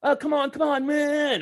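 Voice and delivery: put-on a cocky young guy goading an old man; taunting young punk. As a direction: egg him on like a brash kid. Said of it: "he's like".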